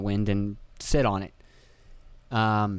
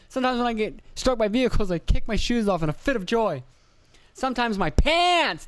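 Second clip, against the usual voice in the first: Dane Cook bit; 10 semitones higher than usual. Retold or imitated. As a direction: ham it up like a stand-up routine. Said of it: "Sounds like a Dane Cook joke".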